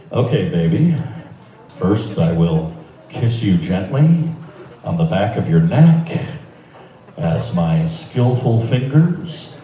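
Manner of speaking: low, slow public radio voice; calm, low NPR host murmur. Pretend. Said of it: "I have a radio voice".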